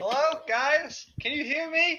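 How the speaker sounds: teasing faraway hello; mock far-off voice. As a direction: sound distant and thin on purpose. Mocking a far-off mic.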